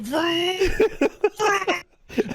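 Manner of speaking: high mock vroom, dissolving into laughter; toy car vroom. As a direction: play-act a kid's car noise while laughing. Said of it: "she's literally going".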